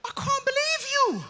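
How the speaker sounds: high-pitched, affronted wail; outraged English lady. Acted. English lady fuming at the man at auditions, outraged. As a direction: squeal with English indignation. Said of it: "she was turning red".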